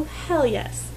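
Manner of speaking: usual speaking voice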